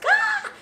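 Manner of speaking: very high mock shriek; imitated scream. Coworkers opening the donut box, mock shriek. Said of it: "scream coming from that office".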